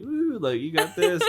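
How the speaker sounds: a lazy cheer from the sidelines, high and loud. Retold or imitated. Jake cheers Finn on from the ground, high mock cheer. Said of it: "He's like".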